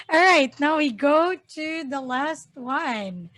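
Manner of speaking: usual speaking voice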